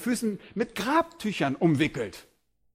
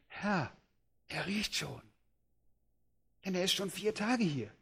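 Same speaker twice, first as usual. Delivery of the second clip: much softer, hesitant reply; hushed objection; 2 semitones higher, 12 dB quieter than usual. Acted. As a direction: murmur the worry.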